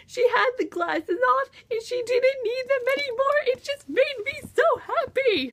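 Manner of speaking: high, emotional squeal of joy; gushing. Real, not acted. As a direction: gush in a very high, choked-up voice. Gushing over the happy ending.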